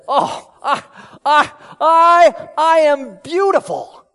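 loud, high, drawn-out mock self-admiration; hammed-up emphasis. Preacher pretending to admire himself in the mirror, hammed-up emphasis. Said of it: "with that kind of emphasis".

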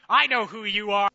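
possessed man shouting. Acted. Possessed man in the crowd shouting at Jesus, loud cry. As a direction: cry out against the Holy One. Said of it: "started shouting".

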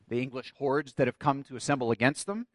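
ordinary talk

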